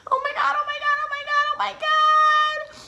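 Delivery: high squealing panicked oh my God; mock panic. Caught stealing signs, squealing in mock panic. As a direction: shriek it in mock panic, singsong.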